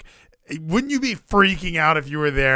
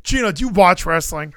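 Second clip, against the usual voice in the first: normal talking voice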